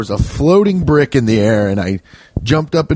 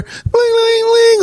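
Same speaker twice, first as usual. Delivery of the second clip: ring sound effect; slower than usual. Retold or imitated. Imitating Sonic's rings spilling.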